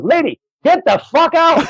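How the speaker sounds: very high-pitched comic outburst; imitated exasperated yell. Club cleaner throwing out a lingering customer, imitated exasperated yell. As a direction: yell her out of the place. Cleaner ordering woman out, retold.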